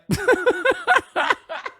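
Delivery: a burst of high laughter; real laugh. Real, not acted. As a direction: laugh it off after being caught out. Owned by a chat correction.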